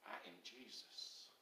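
very quiet, low repeat; hushed. Acted. Jesus answering Saul on the road, hushed. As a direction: let it drop almost to nothing, low and hushed.

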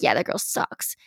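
low, soft, breathy sneer; low whispered put-down; dismissive hater. Retold or imitated. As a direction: mutter it like a bored hater. Imitating dismissive people.

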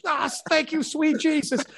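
high, mock-holy cry of relief; relieved shout. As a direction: thank heaven, hammed-up. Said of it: "shouting up from my chair".

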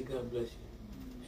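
soft slow blessing. Pretend. Pretend quick blessing.

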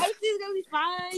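high, laughing self-reassurance; laughing denial. Real, not acted. Retelling her denial to friends, laughing and high.

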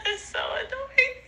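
high, helpless, half-crying laughter; laughing through words. Real, not acted. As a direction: squeeze the words out between laughs. Talking through an uncontrollable laughing fit.

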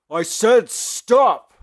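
slow, high furious yell; angry shout. Acted. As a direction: bellow the order slowly. Hero repeats order, furious.

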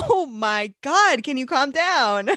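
loud, drawn-out exasperated plea. Acted. Reader answering a shouting caps-lock title, exasperated. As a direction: stretch it out, loudly fed up.

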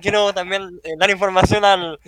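loud, high, through laughter; laughing defensively. Real, not acted. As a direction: protest while cracking up. Defending himself while laughing.

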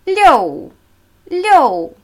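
"Liu" starting high and loud, dropping sharply; falling fourth tone. Shown as a demonstration. Teacher demonstrating the number six, falling fourth tone. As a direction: let "Liu" fall steeply from the top. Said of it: "Der vierte fallende Ton".

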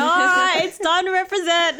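high, loud flag-draped bravado; mock Aussie patriot. Co-host imagining the actor wearing the flag, mock bravado. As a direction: ham up a patriotic rallying cry. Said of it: "Doing, doing my best Cronulla impression".

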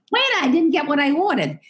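a toned-down imitation of the indignant Brit; milder British tourist. British tourist grumbling at the waiter, lightly mocked. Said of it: "it's almost like".

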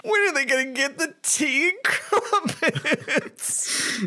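high, drawn-out cartoon-kid whine; slow, impatient mock wail; Milhouse. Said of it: "like fucking Milhouse watching the Poochie".

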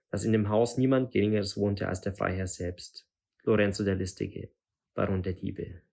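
ordinary delivery

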